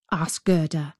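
ordinary delivery

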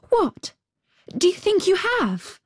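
put-on very high, eager little girl; excited cry. A narrator's reading. Little girl hears the crow may know, high excited cry. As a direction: exclaim high in a young girl's voice. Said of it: "cried the little girl".

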